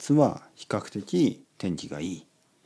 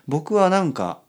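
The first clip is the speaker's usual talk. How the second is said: ordinary talk, nothing marked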